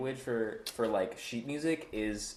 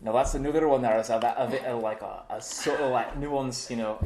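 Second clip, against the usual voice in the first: a Scottish accent with rolled r; broad Scottish brogue. Shown as a demonstration.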